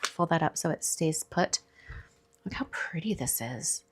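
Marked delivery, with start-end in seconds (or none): 2.4-3.8 soft unvoiced whisper. Real, not acted. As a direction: breathe it out in a whisper. Card admired in a hush.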